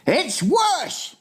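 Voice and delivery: very high, emphatic giant; alarmed cry. A narrator's reading. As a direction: cry it as a dire warning. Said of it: "cried the B F G".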